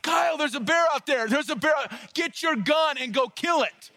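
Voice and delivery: high, urgent re-enacted yell; retold panicked yell. Camper bursting in to forest ranger about a bear, retold high yell. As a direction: cry out a high-pitched alarm, acting it out. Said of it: "and I yelled".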